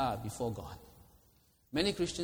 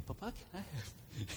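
put-on soft, slow wheedling child; a hushed, syrupy kid buttering up Papa; sweet-talking child; 3 semitones higher, 10 dB quieter, slower than usual. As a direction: coax Papa sweetly for something.

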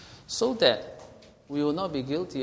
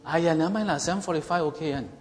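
Singlish; 2 semitones higher, faster than usual. Retold or imitated. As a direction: brush it off in Singlish. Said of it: "being presumptuous means".

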